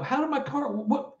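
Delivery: startled, can't finish the question; high stunned confusion. Retold or imitated. Narrator reliving the vanishing car: high stunned confusion. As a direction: pitch leaps up, lost for words.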